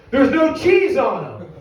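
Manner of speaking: high, loud, drawn-out outrage; customer's angry yell. Acted. Customer opens remade burgers and yells at manager, outraged. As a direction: shout it slowly in disbelief. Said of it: "is yelling at me".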